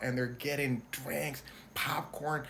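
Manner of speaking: normal talking voice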